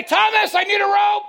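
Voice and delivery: high loud mock call for help; mock shout. Pastor joking about what Peter didn't shout, loud. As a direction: yell it as a joke alternative. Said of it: "He didn't say".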